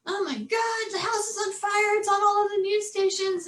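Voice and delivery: high, sustained imitated wail; mock a frantic caller in a high voice; panicked husband. Husband phoning about the burning house, mock panic.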